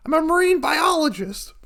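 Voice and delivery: put-on high, loud teenage voice; dropping the act. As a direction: announce it loud and high-pitched. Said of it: "You can just be yourself".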